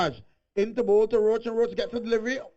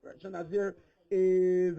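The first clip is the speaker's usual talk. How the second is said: ordinary talk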